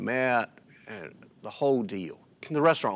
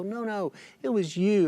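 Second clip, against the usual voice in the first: higher-pitched, earnest protest; retold insistence; 9 semitones higher than usual. Family crediting the giver, insistent. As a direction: imitate someone refusing to let him deflect thanks.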